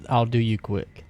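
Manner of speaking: ordinary talk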